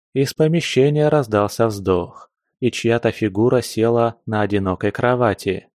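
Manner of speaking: ordinary delivery, nothing marked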